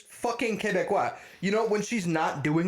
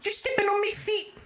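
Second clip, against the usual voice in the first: mock South African yell. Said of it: "I'm like".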